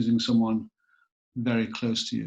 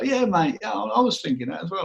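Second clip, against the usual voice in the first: higher-pitched matey London cabbie chat; London accent; 8 semitones higher than usual. Retold or imitated. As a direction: put on a chummy London accent. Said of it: "start speaking in a London accent".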